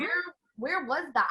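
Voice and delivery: high, loud, incredulous question; a playful shocked where; mock surprise. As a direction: act amazed at the old photo. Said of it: "you're like".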